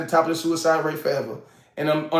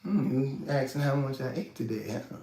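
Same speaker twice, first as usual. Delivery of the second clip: suspicious; 5 semitones lower, 9 dB quieter than usual. Retold or imitated. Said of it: "it's like".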